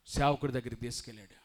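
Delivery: ordinary delivery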